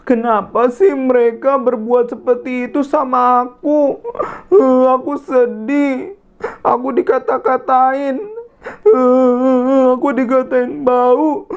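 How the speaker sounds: mild sad self-pity; sad girl complaining. Acted. Dea crying alone in park. (mengeluh dengan sedih)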